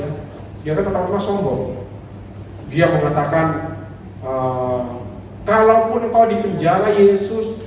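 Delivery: usual speaking voice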